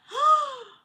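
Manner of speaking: sharp, high, loud gasp of shock. Real, not acted. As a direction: gasp as if scandalised. Shocked by chat comment.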